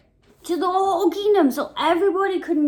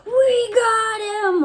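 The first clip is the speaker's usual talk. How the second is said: high cackling gloat, getting louder; gloating laugh; 8 semitones higher, 4 dB louder, much slower than usual. Acted. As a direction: laugh like villains who caught him. Jealous men gloat, laughing.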